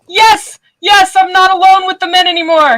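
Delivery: high, loud, delighted shout of welcome; excited greeting. Real, not acted. Host loudly welcoming Helen into a room of men, high and thrilled. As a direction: cheer her arrival like you've been waiting all day.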